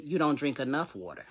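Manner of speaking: low, hushed inner voice; quiet inner voice. Acted. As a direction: voice it softly, like a thought from within. Inner voice speaks in retold dialogue.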